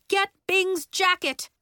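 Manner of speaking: high, loud command; urgent teacher's instruction; calling orders. A narrator's reading. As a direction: order a pupil across the room. Teacher calls orders in emergency.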